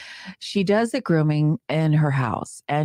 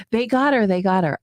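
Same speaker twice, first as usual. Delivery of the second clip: relieved cry; 7 semitones higher than usual. Retold or imitated. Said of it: "and I say".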